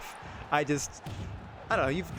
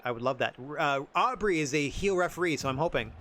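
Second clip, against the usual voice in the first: ordinary talk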